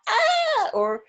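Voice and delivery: a sudden high loud ah; sharp rising cry of upset; distressed yelp. Retold or imitated. Someone getting edgy, high startled ah.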